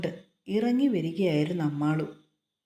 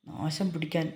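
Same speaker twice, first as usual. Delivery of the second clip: angry; 2 semitones lower, 4 dB quieter than usual. A narrator's reading. Husband curses after berating his wife, muttered anger. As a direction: curse under your breath in irritation. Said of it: "അവന് ദേഷ്യം വന്നു".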